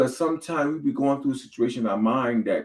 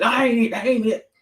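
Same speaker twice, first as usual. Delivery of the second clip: high, louder mocking wife voice; mock denial; 13 semitones higher, 5 dB louder than usual. Husband mimicking his wife denying it, high and loud. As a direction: squeal the denial like an upset wife. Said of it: "She said".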